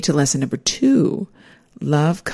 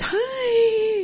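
much higher, bright-toned nervous hi; overly cheerful. Retold or imitated. Greeting her ex-husband in his hospital room, overly cheerful. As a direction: chirp a forced cheery hi. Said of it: "in an overly cheerful voice".

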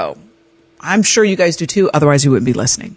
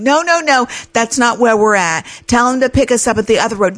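insistent friend; 6 semitones higher than usual. Retold or imitated. Friend objecting.

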